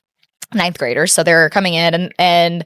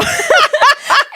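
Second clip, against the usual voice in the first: loud, high cackle; squealing laugh; high laughing shriek; 3 dB louder than usual. Real, not acted. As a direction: burst out laughing. Laughing at friend's story.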